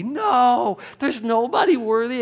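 high, loud outburst of protest; acted indignant shout at the screen; mock screaming at TV. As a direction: yell it like a viewer caught up in the story. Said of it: "you start screaming at your T V".

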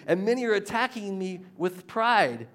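complaining David. Retold or imitated. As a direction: voice David's grievance with rising heat. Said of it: "he's complaining".

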